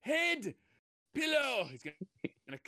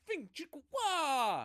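high, drawn-out cartoon zaps; playful sound effects of a disc flying and hitting; disc weapon sounds; 11 semitones higher, much slower than usual. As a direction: slowly spell out each zap like a toy weapon.

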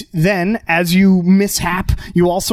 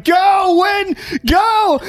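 sideline shout; 14 semitones higher, much slower than usual. Acted. Fan on the sideline cheering Win on, high shout. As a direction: yell it across the field. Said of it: "someone from the sideline shouting".

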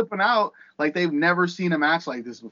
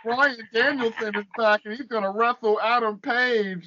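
mock fan voice; 4 semitones higher than usual. Ranting host mimics fans jumping out of nowhere, mock fan voice. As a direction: drag it out as a dopey gushing fan. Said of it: "What is that voice?".

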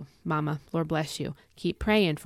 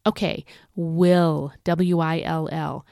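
"will" drawn out slowly and louder, then spelled letter by letter; emphasised word; 4 dB louder, slower than usual. Shown as a demonstration. Preacher spelling out "will" to viewers, slow and emphatic. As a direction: slowly hammer home "will". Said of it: "it needs some extra attention".

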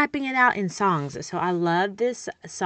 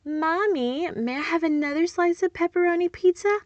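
put-on much higher, sweet little kid voice; child's voice; 10 semitones higher than usual. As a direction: ask politely like a small child. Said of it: "and they say".

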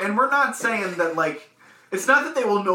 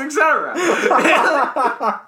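slow words broken by laughter; giggling, barely getting it out; laughing through it; 9 semitones higher, 6 dB louder, much slower than usual. Real, not acted. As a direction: crack up as you read. Laughing while reading the question.

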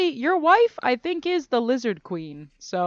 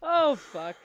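coughing fit; 6 semitones higher than usual. Real, not acted. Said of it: "I'm coughing a lot".

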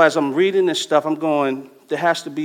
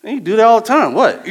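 wife laughing; 7 semitones higher, 7 dB louder than usual. Retold or imitated. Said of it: "she was kinda like".

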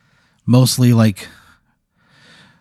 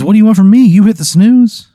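much higher, louder, friendly creature voice; cheery snooze monster; 12 semitones higher, 8 dB louder than usual. Acted. Snooze monster greeting Pat with a high, chummy voice. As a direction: greet him like an old buddy, high and bright.

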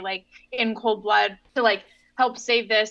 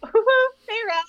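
smitten cooing; 12 semitones higher, 5 dB louder than usual. Retold or imitated. Voicing lovestruck Rhys.

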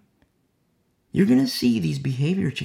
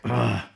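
short, low grunt of annoyance; grumbling groan; 5 semitones lower than usual. Acted. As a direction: grumble under mild frustration. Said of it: "you grumble".

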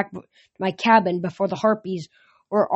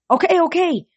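urgent okay; 9 semitones higher, 4 dB louder than usual. Acted. Calming panicked creatures.

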